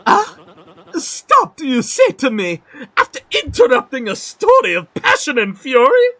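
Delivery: high, indignant merchant; huffy, offended aristocrat; indignant outburst. Acted. Interrupted mid-story, offended.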